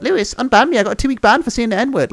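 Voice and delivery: high, whiny mock player voice; mocking a banned player. Streamer imitates a banned player begging to be unbanned. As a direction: lightly mimic a whining kid. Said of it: "there's been people like".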